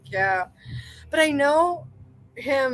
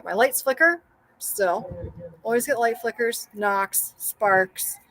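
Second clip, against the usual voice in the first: normal talking voice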